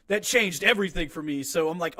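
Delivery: usual speaking voice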